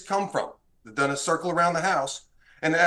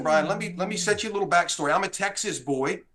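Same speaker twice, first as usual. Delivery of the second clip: ordinary talk, nothing marked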